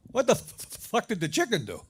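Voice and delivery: loud stutter, then blurt it out; freezing bird forcing out the punchline; shivering parrot. Acted. Frozen parrot asking about the chicken, shivering outburst. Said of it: "there's the little parrot just shivering".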